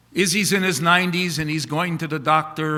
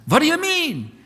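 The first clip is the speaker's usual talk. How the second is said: put-on Yiddish accent; 11 semitones higher, 4 dB louder than usual. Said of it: "What's with the accent?".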